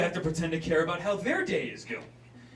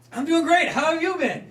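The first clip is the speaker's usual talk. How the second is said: high, loud forced cheer; fake cheerful; 7 dB louder than usual. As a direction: chirp it with fake brightness.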